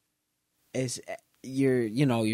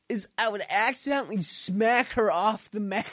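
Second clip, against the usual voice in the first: ordinary talk, nothing marked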